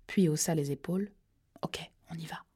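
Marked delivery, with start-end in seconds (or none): 1.6-2.4 a soft, unvoiced go signal; whispered. A narrator's reading. Partner whispering the go before breaching, hushed. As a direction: hush it so nobody inside hears. Cops sneaking up to the door.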